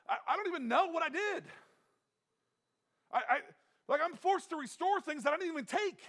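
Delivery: high, loud, pleading cry for help; desperate crying out. Retold or imitated. Preacher voicing David crying to God, high desperate plea. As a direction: cry out to God as if drowning and worn out. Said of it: "how he's just going".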